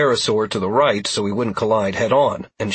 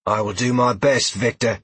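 fierce warrior woman. A narrator's reading. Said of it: "the warrior woman said again".

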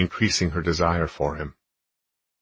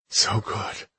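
panting; 4 semitones higher than usual. A narrator's reading. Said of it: "she panted".

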